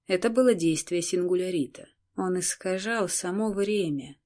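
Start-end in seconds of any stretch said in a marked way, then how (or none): none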